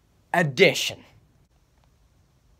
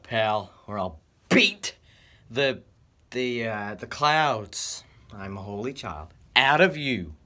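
ordinary talk, nothing marked

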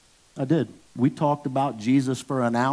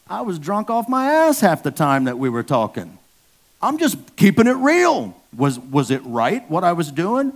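louder, higher preaching burst; 5 semitones higher, 6 dB louder than usual. Acted. Preacher to congregation about being saved while drunk, preaching hard. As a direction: drive the point home from the pulpit.

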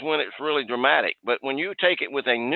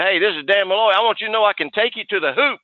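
hyped-up greeting; 6 semitones higher than usual. Retold or imitated. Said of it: "had the guy laughing".

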